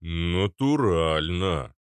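very slow, stretched-out bass; booming bass. A narrator's reading. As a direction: rumble it out syllable by syllable. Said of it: "пробасил Арнольд". (протяжно, басом)

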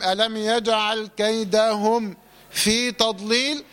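slow recitation broken by a silent stop after كيدهم; mistaken pause after meem. Shown as a demonstration. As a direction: stop dead on كيدهم, then resume with في تضليل. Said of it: "وما تسكتش".

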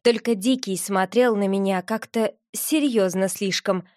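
plain reading voice

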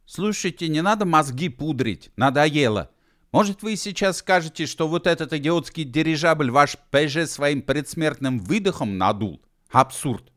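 high-pitched, incredulous tirade; exasperated protest. A narrator's reading. Gedevan disputes the dying-breath airship, exasperated protest. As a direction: argue back, fed up and scoffing.

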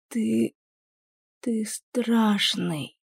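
soft, very slow frightened moan. A narrator's reading. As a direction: moan it out in pain and fear. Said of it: "простонала Кэс".

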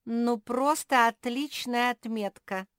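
high, loud mock praise; snorting sarcasm. A narrator's reading. Squirrel scoffing at bear's grade, sarcastic snort. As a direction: scoff with exaggerated admiration. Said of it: "фыркнул Чинк".

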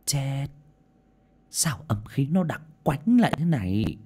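put-on uneasy elderly spirit-seer; old woman, alarmed. A narrator's reading. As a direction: mutter in dismay at the dark air. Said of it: "dừng lại mà nói to".

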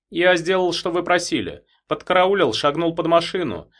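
waiter's voice. A narrator's reading. Waiter reports.

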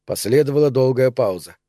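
usual speaking voice

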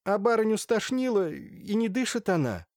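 high-pitched, upset servant report; tearful maid. A narrator's reading. Maid tells the doctor her mistress is not breathing, tearful. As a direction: raise the pitch like a distressed maid. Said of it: "утирая фартуком слёзы".

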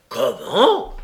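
loud, astonished exclamation; loud one-word outcry of shock; stupefied cry. A narrator's reading. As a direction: burst out in disbelief. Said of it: "s'écria le juif stupéfait".

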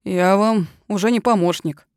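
usual speaking voice

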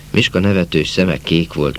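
usual speaking voice

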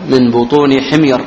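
normal talking voice

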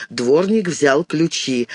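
usual speaking voice

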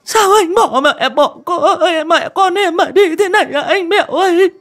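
very high, loud, sobbing lament; choked-up wailing. A narrator's reading. Pregnant widow crying to her dead husband, wailing. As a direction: cry out in long grieving wails. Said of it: "cũng nghẹn ngào".

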